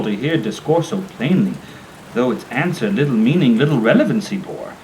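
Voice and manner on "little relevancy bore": ordinary delivery, nothing marked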